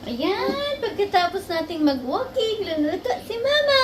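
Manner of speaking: high, loud sing-song shout; hammed-up cheerful cry; excited announcement. Real, not acted. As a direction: announce it like a show host.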